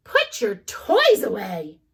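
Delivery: high, loud firm order; stern. Acted. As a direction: order him like a mom. Mom ordering.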